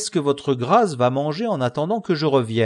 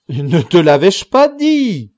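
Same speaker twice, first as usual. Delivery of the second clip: loud, drawn-out indignant cry; exclaiming; 4 semitones higher, 10 dB louder than usual. A narrator's reading. Don Quichotte to his squire Sancho, exclaiming loudly. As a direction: cry it out high and slow. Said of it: "s'écria Don Quichotte".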